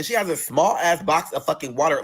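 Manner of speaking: ordinary talk, nothing marked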